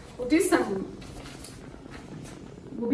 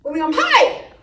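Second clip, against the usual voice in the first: HIGH leaps up, louder; 3 semitones higher, 6 dB louder, faster than usual. Shown as a demonstration. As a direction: send the word high up brightly in pitch. Voice mimics high versus low.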